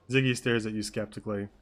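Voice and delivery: usual speaking voice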